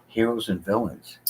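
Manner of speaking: usual speaking voice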